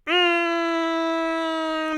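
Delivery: high, soft, steady held hum; long hum. Real, not acted. Host moving on to the next story, long hum.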